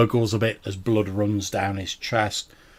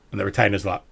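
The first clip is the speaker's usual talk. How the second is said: ordinary talk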